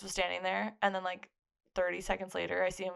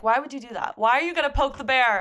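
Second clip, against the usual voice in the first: loud, higher mock reproach, building on the second question; retold exasperated scolding; 5 semitones higher, 9 dB louder than usual. Friend retells being told off, exasperated scolding. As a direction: imitate a friend's loud disbelief.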